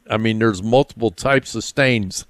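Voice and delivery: ordinary talk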